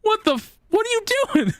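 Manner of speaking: very high squeaky what are you doing; exasperated disbelief. Retold or imitated. Retelling his reaction to her secret kid, high mock exasperation. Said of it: "but, like".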